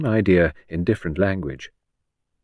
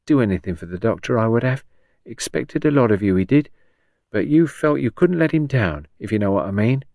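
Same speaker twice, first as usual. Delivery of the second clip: higher, lilting Cockney storyteller; animated old Cockney patient; 5 semitones higher, 3 dB louder than usual. Acted. Patient quotes her doctor's encouragement, animated Cockney. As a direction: relive the pep talk with relish.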